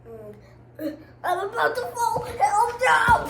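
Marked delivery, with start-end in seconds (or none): 1.2-3.3 high, loud alarmed yelp; real panic at falling; startled outcry. Real, not acted. Player nearly falling, crying out loud and high. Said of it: "reader reacting while playing".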